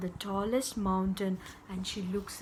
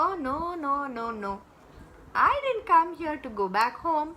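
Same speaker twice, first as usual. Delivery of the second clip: put-on high, protesting girl; Mohini; 5 semitones higher, 4 dB louder, much faster than usual. Girl refusing to be sent home, high protest. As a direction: refuse brightly, quickening as it goes. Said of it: "said Mohini".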